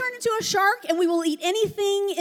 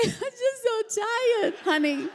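mock whiny tired mom; 9 semitones higher than usual. Mom whining to her husband at day's end. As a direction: whine it like the toddler, but as a worn-out mom. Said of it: "that's how we feel at the end".

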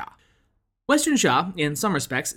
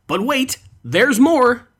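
Billy Mays; 8 semitones higher, 7 dB louder, slower than usual. Retold or imitated. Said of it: "in the words of the late great Billy Mays".

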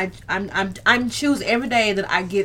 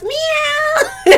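loud, very high meow through a laugh; 6 dB louder than usual. Pretend. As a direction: yell a meow while cracking up. Teasing sign-off, laughing.

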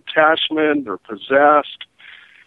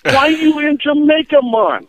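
put-on much higher, baffled Jamaican accent; mock the hustler's disbelief; incredulous Jamaican; 12 semitones higher, 4 dB louder than usual.

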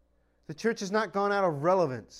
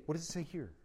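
soft rhetorical question; 2 semitones lower, 9 dB quieter, faster than usual. Acted.

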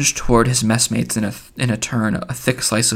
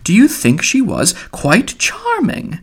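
high, sweet voice, drawn out at the end; finical, sweet; 10 semitones higher, slower than usual. A narrator's reading. Mulligan cooing over the kinswoman, finical sweetness. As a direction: pitch it high, fussy and sugary. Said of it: "in a finical, sweet voice".

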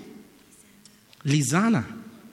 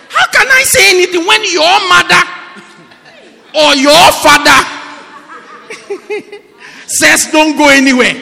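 higher, louder shouting with laughter; laughing shout; 11 semitones higher, 9 dB louder than usual. Real, not acted. Preacher jokes with the congregation, laughing shout.

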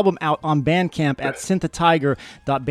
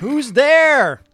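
shouting; 9 semitones higher, 7 dB louder than usual. Acted. Shouted reply to knock-knock.

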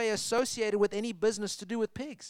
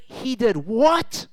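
slow, drawn-out he did what; shocked disbelief; 4 semitones higher, 4 dB louder, much slower than usual. Retold or imitated. Stunned audience hearing the father ran, slow disbelief. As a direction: let the shock stretch every word. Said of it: "the whole audience is going".

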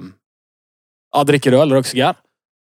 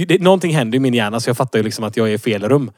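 normal talking voice

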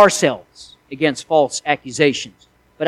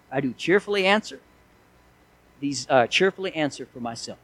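softer, calm reply with a smile; cheerful, smiling answer; 3 semitones lower, 9 dB quieter than usual. Acted. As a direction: answer gently, without any anger. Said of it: "big old smile on his face".

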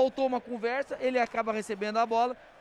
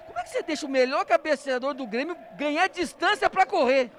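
excited; 6 semitones higher, 5 dB louder than usual. Real, not acted. Commentator excited after goal.

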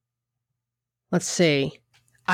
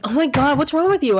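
higher, mock-offended voice of a dismissive person; mocking exasperation; 6 semitones higher than usual. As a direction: act put out, as if the other is overreacting.

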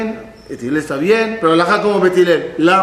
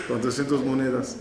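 aside; 4 semitones lower, 10 dB quieter than usual. Acted. Storyteller corrects the sum, aside.

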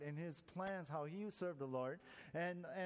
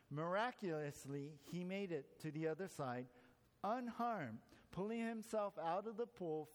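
normal talking voice

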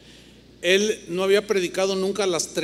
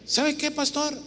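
bitter daughter; 8 semitones higher, 3 dB quieter than usual. Retold or imitated. Daughter voiced.

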